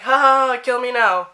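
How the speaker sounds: loud, high forced "ha ha"; mock embarrassed laugh. Re-enacting her reply after he saw his picture, forced embarrassed laughing. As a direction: fake a big laugh to cover mortification.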